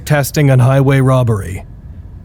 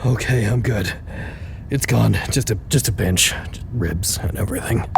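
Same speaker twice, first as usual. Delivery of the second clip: winded; 4 semitones lower, 8 dB quieter than usual. Acted. Recovering after pain.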